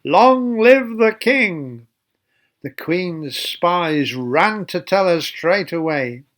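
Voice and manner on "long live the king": slow, high cheering call; crowd cheer. Acted. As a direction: cheer the new king like the whole crowd. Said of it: "everyone shouted".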